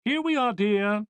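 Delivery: mother calling out. Acted. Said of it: "Missus Thayer called out".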